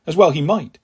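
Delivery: usual speaking voice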